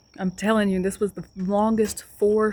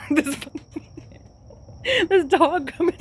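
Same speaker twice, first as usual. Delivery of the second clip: ordinary talk, nothing marked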